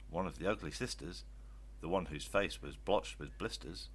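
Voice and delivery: ordinary delivery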